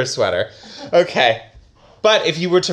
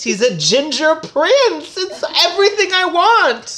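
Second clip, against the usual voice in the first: mock swoon; 13 semitones higher, 3 dB louder than usual. Swooning over prince.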